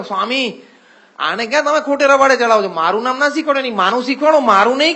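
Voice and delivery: a retold father's huffy objection; indignant father. Father protests to Swami.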